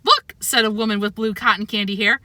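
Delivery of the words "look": high loud delighted exclamation; excited old lady. A narrator's reading. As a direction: squeal with delight. Old woman spots a cat.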